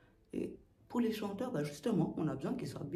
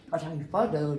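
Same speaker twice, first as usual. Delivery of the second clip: normal talking voice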